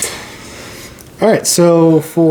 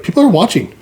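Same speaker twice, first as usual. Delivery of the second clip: lightly mimicked partner, pitched higher; imitating partner; 8 semitones higher, faster than usual. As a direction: quote her in a higher, mock-amazed voice. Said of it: "all of a sudden she's like".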